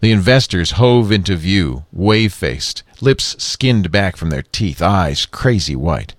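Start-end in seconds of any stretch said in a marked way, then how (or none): none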